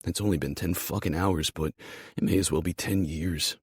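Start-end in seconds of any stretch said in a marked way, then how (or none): none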